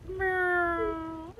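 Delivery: high sad droid whimper. Pretend. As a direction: sad little robot moan. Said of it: "like when R two is sad".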